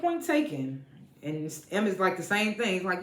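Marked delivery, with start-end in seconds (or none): 0.0-0.8 much higher, mock-conceding aside; high-pitched concession. Recapper conceding the mother's point, high-pitched aside.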